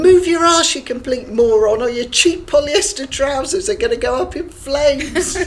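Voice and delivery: replaying an old outburst, loud and gleeful; retold shout. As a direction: bellow it like you're back in that lab.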